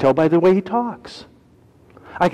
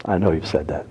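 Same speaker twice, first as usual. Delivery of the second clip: quiet; 10 semitones lower, 6 dB quieter than usual. Shown as a demonstration.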